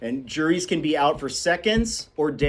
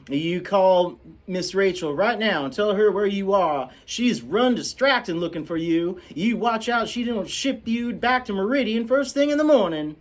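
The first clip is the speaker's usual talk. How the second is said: high, loud scolding housekeeper; scolding Calpurnia; 9 semitones higher, 4 dB louder than usual. Acted. Calpurnia scolding the children on the way home. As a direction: tear into the children.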